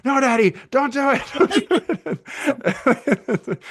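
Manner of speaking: put-on high, laughing child's plea; mock the little boy begging, voice up high; imitated pleading child. Father imitating his son begging not to go in shower: high laughing child plea.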